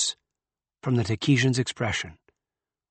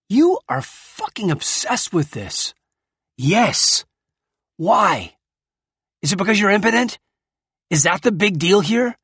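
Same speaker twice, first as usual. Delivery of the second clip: loud, high exasperated anger; heated; 9 semitones higher, 7 dB louder than usual. Acted. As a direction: hurl it at him. Heated argument in story.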